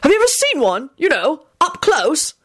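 high, eager wonder; eager, high-pitched curiosity; beaming delight. A narrator's reading. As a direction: ask like a thrilled enthusiast. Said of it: "Arthur beamed".

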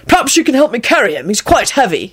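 quick, mannerly request; lightly polite and brisk, pitch up at first. A narrator's reading. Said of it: "he said politely".

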